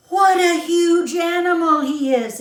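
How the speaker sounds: high, loud cry of wonder from a little mouse; a tiny mouse gasping at the giant lion; excited exclamation. A narrator's reading. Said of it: "exclaimed the youngest mouse".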